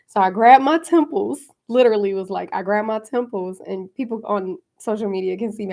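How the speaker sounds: ordinary talk, nothing marked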